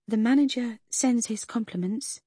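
a waitress nervously passing on a rude request; sheepish waitress. A narrator's reading. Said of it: "she began sheepishly".

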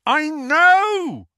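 high, loud bellow. Acted. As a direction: bellow it out in frustration. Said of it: "Harry bellowed".

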